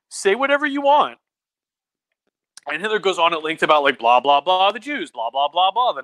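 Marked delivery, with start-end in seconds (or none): 0.1-1.2 high, loud mock-breezy judge; careless lenient judge. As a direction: wave him on, all generosity. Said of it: "the judge is just like".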